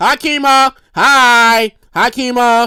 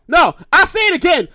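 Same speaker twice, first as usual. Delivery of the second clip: ordinary talk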